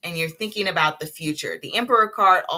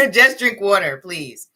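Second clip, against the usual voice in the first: laughing, louder plea to herself; 3 semitones higher, 7 dB louder than usual. Retold or imitated. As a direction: laugh while pleading with yourself to drink water. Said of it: "'cause I'm like".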